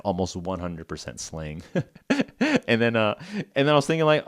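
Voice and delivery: ordinary talk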